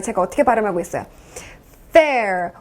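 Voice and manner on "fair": drawn "fair" with a clear English F; teeth-on-lip F. Shown as a demonstration. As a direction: bite the lower lip for the F of "fair". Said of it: "위 치아가 아랫입술을 물고 있어요".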